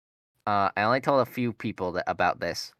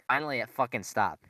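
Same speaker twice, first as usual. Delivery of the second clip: ordinary talk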